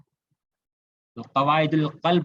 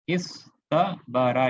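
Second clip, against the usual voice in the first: इस तबारा spoken very slowly, each syllable held; teacher slowly modelling इस तबारा for the class; slow demonstration; much slower than usual. Shown as a demonstration. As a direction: draw out इस तबारा so every letter is heard. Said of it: "ये original ऐसा था".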